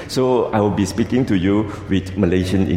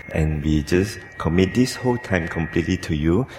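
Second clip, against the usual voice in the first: prayer; 4 semitones lower than usual. Acted. Leads congregation in prayer.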